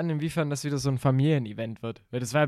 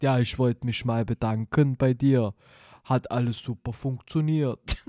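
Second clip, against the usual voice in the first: put-on voice of a young man; disguised deep voice. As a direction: fake grateful testimonial, lightly disguised. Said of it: "spricht mit verstellter Stimme".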